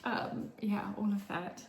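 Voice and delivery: usual speaking voice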